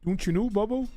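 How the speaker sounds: light imitation of a Minnesota accent. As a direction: toss off a jokey Minnesota "don't you know". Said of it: "if somebody says they're from Minnesota".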